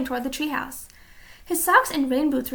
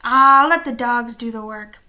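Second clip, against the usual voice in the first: loud gruff pirate growl; pirate captain growling at his crew; 2 semitones lower, 8 dB louder than usual. A narrator's reading. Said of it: "growled Captain Bones".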